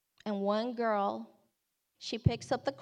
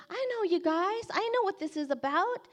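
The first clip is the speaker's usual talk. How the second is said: high-pitched, keen imitation of a girl; eager teen; 8 semitones higher than usual. Girl tells the outreach team she knows this, eagerly.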